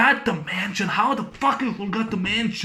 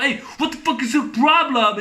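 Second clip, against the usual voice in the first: higher, shouted challenge; mock thug shout; 6 semitones higher than usual. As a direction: yell it like a street thug.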